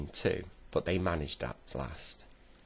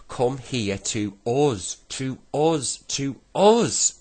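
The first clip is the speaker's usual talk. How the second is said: slow, high call building louder; despairing shout; 8 semitones higher, 10 dB louder, slower than usual. A narrator's reading. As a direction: shout it word by word, more desperately each time. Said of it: "shouted Joe in despair".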